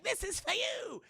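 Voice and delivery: high, drawn-out mock taunt; acted villain taunt. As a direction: call it out high and slow, playfully menacing. Retelling film villain throwing pan.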